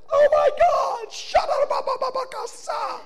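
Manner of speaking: high, loud hammed-up panicked babble; mock panic. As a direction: cry out in exaggerated terror, spilling into nonsense syllables. Mocks a panicked reaction to demon.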